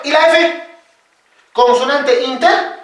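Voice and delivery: ordinary talk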